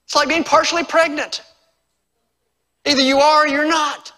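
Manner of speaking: high, loud pulpit delivery; emphatic preaching. Real, not acted. Preaches at full force.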